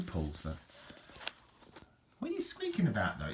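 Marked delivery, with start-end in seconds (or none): 2.2-3.3 much higher, sing-song pet voice; talking to the dog. Real, not acted. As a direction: coo at the squeaky dog.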